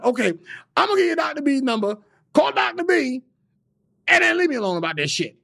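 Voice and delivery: put-on high, loud gruff old man voice; angry old man. [name] mimics Dick Gregory calling back, high and loud. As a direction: bark it like a cranky old man.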